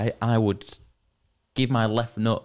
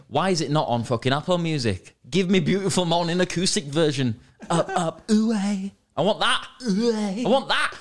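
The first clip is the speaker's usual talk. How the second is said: high, rising mock tantrum; playful high-pitched pleading; mock demanding fan; 8 semitones higher than usual. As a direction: demand it like an over-keen fan, getting higher. Comic fan demand for acoustic version.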